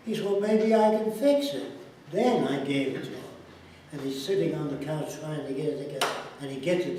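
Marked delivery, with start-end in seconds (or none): 0.2-1.7 put-on higher and louder, eager child's voice; little boy hoping he can fix the toy; hopeful little boy. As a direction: play the small son perking up. Son voiced in story.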